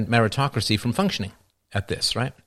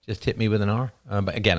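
usual speaking voice

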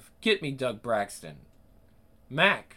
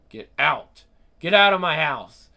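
furious shout; 10 dB louder than usual. Acted. Furious host throws visitor out.

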